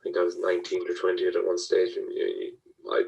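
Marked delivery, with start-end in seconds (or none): none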